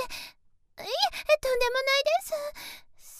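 high-pitched, hurried denial; polite, startled protest; flustered modesty. Acted. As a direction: jump in to wave away the praise. Flustered denial of the guru's praise.

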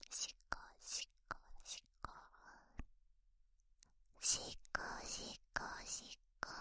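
rhythmic breathy whispering; ASMR whisper. Acted.

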